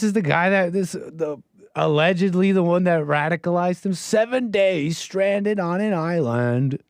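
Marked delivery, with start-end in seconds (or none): 3.9-6.8 higher, drawn-out title read; mock title announcing. Commentator reading out YouTube title, drawn-out announcing. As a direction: stretch it out like a dramatic teaser. Reads out hype video title.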